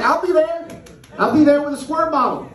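high-pitched mock threat; taunting comeback. Owner answering his dragged-away dog, taunting. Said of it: "And I'm like".

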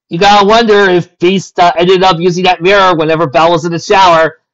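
loud, high, teasing comment; cheeky aside to the audience; amused aside. Real, not acted. Reader's joking aside.

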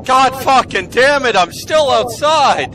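loud, high frustrated yell; angry outburst. Real, not acted. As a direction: explode in frustration.

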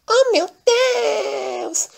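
very high, very slow wail; hammed-up wail of a girl who just fell; wailing cry. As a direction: wail it out, mock-distraught. Fiona falls, skirt breaks.

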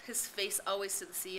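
ordinary delivery, nothing marked

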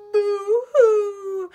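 loud, high storybook boo-hoo; mock crying. Scared rat woken by a sneeze, mock crying. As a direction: wail it like a cartoon crybaby. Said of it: "who cried".

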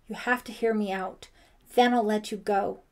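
loud, urgent plea with a touch of gasping; gasped. A narrator's reading. Brian begging Ellie to hear him out, gasped. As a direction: press each phrase out loudly. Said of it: "he gasped".

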